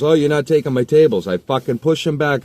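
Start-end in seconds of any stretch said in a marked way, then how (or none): 0.0-1.3 loud, high defiant protest; kid's angry protest. Retold or imitated. Kid refusing to give up tables, loud and higher. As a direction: push back hard. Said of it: "He goes".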